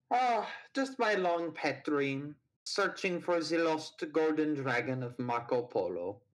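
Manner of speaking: lightly voiced German villain; German accent. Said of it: "Are you mocking my accent".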